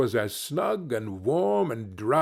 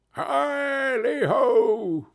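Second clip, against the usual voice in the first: long slow whoop, much higher; merry cry; 11 semitones higher, much slower than usual. Acted. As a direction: sing out a joyful hail. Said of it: "cried old Fezziwig".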